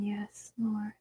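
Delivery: breathy whispered prayer; whispering. Real, not acted. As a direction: whisper it to God.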